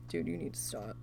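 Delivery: low, hushed whisper; a whispered warning to a power-tripping boss; whispered aside. Retold or imitated. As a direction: mutter it under your breath. Inner thought about power-drunk manager.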